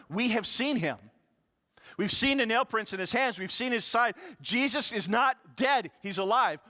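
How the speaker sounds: loud, high and urgent, pleading to be believed; insistent disciples. Acted. Disciples urging Thomas, loud and high. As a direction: disciples telling Thomas again and again they saw him, loud and urgent.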